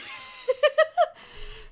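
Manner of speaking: high, loud laughing fit. Real, not acted. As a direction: burst out laughing at yourself. Laughing at own attempt.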